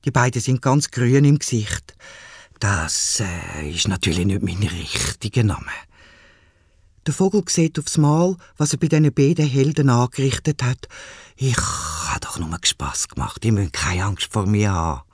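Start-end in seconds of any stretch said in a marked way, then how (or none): 2.6-5.7 lower, soft and hoarse big bird; soft hoarse vulture. Acted. Vulture character speaks. 11.4-15.0 put-on gently calm two frightened boys; soft reassuring vulture.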